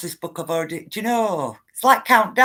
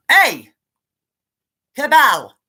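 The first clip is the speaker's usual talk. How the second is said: loud, raised shout at an enemy; shouted challenge; 4 semitones higher, 7 dB louder, slower than usual. Acted. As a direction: call them out sharply and loudly. Shouts warning at imagined cabal.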